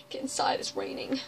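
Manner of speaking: hushed, breathy whisper. Acted. Cowboy telling everyone to get inside, whispered. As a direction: whisper it like a secret.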